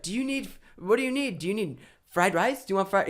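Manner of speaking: eager local host. Retold or imitated. Eager local offering food.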